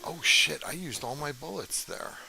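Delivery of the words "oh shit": startled curse. Real, not acted. Realizes ammo is gone.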